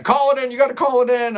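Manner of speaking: high-pitched mock yell; half-shouted, insistent retelling; imitated yelling customer. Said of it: "So he's screaming and yelling".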